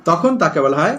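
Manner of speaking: usual speaking voice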